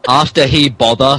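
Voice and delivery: usual speaking voice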